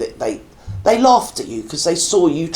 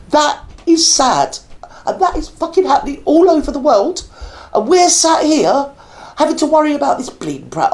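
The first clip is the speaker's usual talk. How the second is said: emotional outburst; 8 semitones higher than usual. Real, not acted. Reaction video host overcome by a child's story, emotional and raised. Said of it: "if I'm getting emotional".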